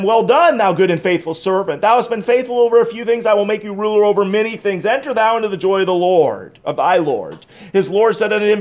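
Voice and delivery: high, loud sermon delivery; full-force preaching. Real, not acted. Preacher reading the master's commendation to the congregation, preaching at full force. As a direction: proclaim the scripture to the whole congregation.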